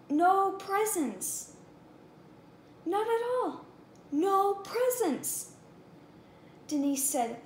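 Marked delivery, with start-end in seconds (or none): 0.1-1.5 high, slow, drawn-out NO presents; dismayed child. Acted. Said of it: "It's Christmas Eve". 2.8-5.5 high, slow lament, hitting no; a hint of a disappointed child; lightly voiced upset girl. Julie laments on the phone.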